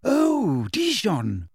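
high-pitched delighted exclamation; light up with eager recognition; excited gush, starting very high. A narrator's reading. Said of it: "said Hermione excitedly".

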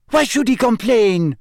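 loud, high French outburst; bursting out. A narrator's reading. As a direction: blurt it in protest. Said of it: "burst out Fleur Delacour".

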